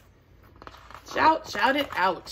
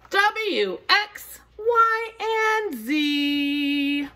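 plain reading voice

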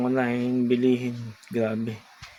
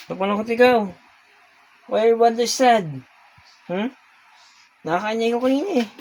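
high, louder cooing to a pet; 10 semitones higher, 4 dB louder, faster than usual. Real, not acted. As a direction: coo at the sulking cat. Baby talk to sad cat. (malambing na baby talk sa pusa)

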